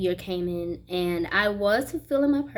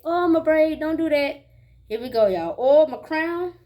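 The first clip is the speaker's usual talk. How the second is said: startled about braid; 5 semitones higher, 5 dB louder than usual. Real, not acted. Braid falls off mid-video.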